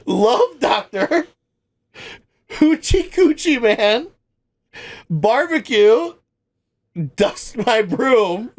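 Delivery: real laughter breaking into every title; laughing through it. Real, not acted. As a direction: read the titles while cracking up. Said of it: "I can't get through it without laughing".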